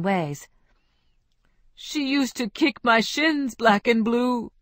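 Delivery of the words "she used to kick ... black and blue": lightly voiced lovesick man, slow and fond; fond lament. Ruben telling friends of Isabel, slow and fond. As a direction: savor the bruises like a tender memory. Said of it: "he would say fondly".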